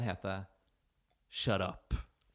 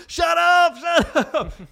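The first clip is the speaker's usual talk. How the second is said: screaming high and loud; shrill anger; 18 dB louder, much slower than usual. Retold or imitated. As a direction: scream it at her, furious. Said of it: "Så han skriker til henne".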